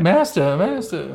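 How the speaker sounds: high, prissy droid voice; whiny robot butler; C-3PO voice. Retold or imitated. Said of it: "it's like the C three P O comm link".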